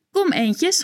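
high, coaxing call; calling to the ducks. A narrator's reading. Evelientje calls the ducks, high coaxing call. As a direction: call the ducks over sweetly. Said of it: "roept ze".

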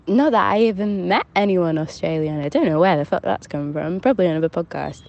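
ordinary delivery

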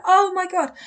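high, loud exclamation of astonishment; retold amazement. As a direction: relive the shock for listeners. Her own amazement re-enacted.

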